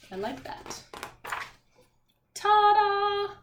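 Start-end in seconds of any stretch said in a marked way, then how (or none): 2.3-3.3 high, bright ta-da with a gasp. Acted. Teacher revealing the book's last page to kids, a showy ta-da. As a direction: present it like a magic trick. Book reveal read to class.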